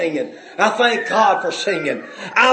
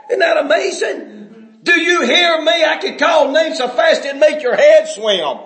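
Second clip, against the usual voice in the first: preaching at full force; 8 semitones higher than usual. Acted. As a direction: thunder it from the pulpit at the greedy. Preacher shouts against greedy churches.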